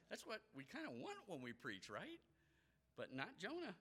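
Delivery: ordinary delivery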